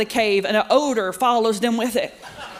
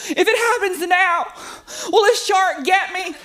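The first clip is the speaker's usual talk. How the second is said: higher, a lightly voiced frightened kid; scared daughter; 8 semitones higher than usual. Daughter asking mom in the ocean, mildly frightened. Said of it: "She's shaking and crying".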